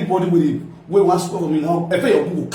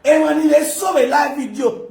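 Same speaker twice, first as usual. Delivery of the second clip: shouting; 8 semitones higher than usual. Real, not acted. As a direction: yell it out to the live viewers. Shouting during live rant.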